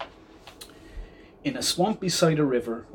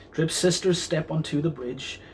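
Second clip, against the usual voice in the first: ordinary delivery, nothing marked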